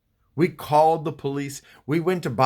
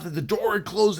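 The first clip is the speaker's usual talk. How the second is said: ordinary delivery, nothing marked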